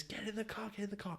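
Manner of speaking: high, hushed get in the car. Retold or imitated. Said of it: "all Trevor's saying to me is".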